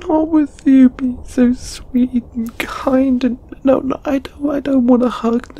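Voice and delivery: very high, overwrought stammering; distraught refusal. Acted. Refusing a friend's kindness and hug, high and distraught. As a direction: protest in a high, upset voice.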